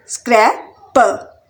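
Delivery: Scraper slowly broken in two; split into syllables. Shown as a demonstration. Teacher showing learners the syllables, syllable by syllable. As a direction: pause between स्क्रै and पर. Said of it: "इसमें भी three syllable".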